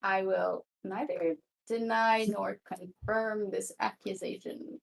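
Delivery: normal talking voice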